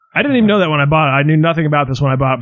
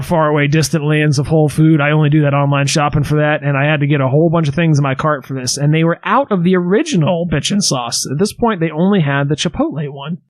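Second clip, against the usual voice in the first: usual speaking voice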